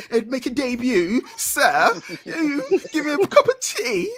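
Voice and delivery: high, hammed-up proper British voice; bad British accent. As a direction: put on a slow, silly, posh British accent. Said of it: "I'm British. I do a shit accent".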